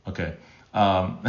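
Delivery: usual speaking voice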